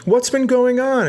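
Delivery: very high, gushing squeal of a reunion; high-pitched excited greeting between old acquaintances; gushing customer. Retold or imitated. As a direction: squeal it way up like bumping into an old friend. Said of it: "the lady customer ... goes".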